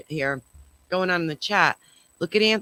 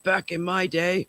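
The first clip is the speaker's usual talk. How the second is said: lightly voiced old man, slow; creaky grandpa complaining. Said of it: "My old man voice".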